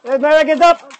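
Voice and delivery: high, loud burst of alarm; sudden panicked yelling; frantic shouting. Real, not acted. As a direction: holler urgently as if everything is going wrong. Sudden alarm during the livestream.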